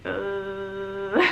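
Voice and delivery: drawn-out groan of discomfort. Retold or imitated. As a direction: act out a long "ugh". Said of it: "So I was like".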